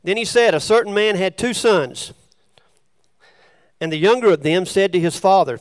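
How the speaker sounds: loud, measured Bible reading; scripture reading. Acted. Preacher reads the prodigal son parable to listeners, loud scripture reading. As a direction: read the parable out to the room.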